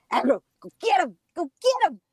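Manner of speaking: high, urgent command to a dog; egging on the dog. Acted. Owner siccing her dog on her husband, excited egging on. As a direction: hype the dog up to pounce. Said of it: "or if I say".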